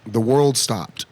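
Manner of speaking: ordinary talk, nothing marked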